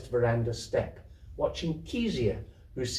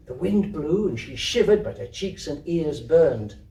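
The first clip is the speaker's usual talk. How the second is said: ordinary delivery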